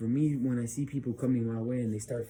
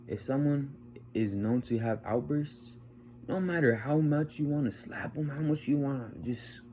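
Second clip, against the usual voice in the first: normal talking voice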